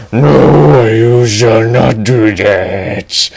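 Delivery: slow booming dragon refusal. Acted. The dragon refusing Edge's demand, slow booming voice. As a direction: forbid it like a great beast.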